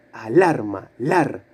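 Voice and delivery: "Alarma" with the weight on LAR, then "lar" alone; "Alarma", then its strong syllable "lar" isolated; stressed middle syllable. Shown as a demonstration. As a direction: lean on the LAR of "Alarma", pitch dropping low. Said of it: "¿Cuál de las sílabas suena más fuerte?".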